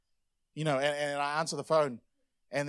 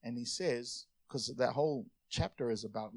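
ordinary delivery

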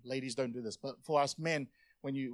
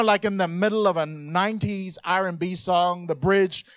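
usual speaking voice

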